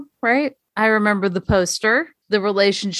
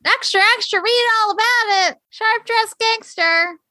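put-on old newsreel; 13 semitones higher than usual. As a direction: shout the headlines like an old newsboy. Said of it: "it reads like one of the old newsreels".

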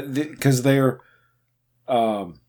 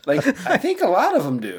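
laughing; 7 semitones higher than usual. Real, not acted. Laughing at the lyric.

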